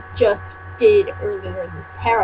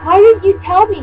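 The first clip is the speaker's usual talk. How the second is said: hammed-up shout, higher and louder; mock shout; 9 semitones higher, 6 dB louder than usual. Reader voicing Draco shouting at Ebony, hammed-up yell. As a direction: yell it like a wounded teen boyfriend. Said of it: "he shouted".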